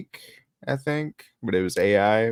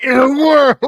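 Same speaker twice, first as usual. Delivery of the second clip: booming, high trailer announcer; "In a world" like an epic trailer; movie trailer voice; 14 dB louder than usual. Retold or imitated. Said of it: "Everyone at the same time was just like".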